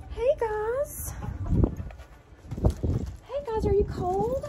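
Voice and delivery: high sing-song greeting to the hens; high chicken-calling voice. Real, not acted. To her hens in the cold coop, a high sing-song greeting. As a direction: coo up high like calling pets.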